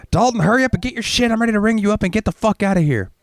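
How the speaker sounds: mocking high, impatient coworker voice. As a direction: nag him to hurry like a bored cashier.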